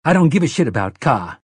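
crude, no arguments allowed; harsh, crude Detta. Acted. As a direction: Detta Walker snapping at Mia. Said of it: "harsh and crude and brooked no argument".

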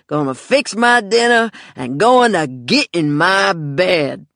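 slow, loud, slurred sneer; drunk mean uncle. Acted. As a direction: cruel drunk man, slow.

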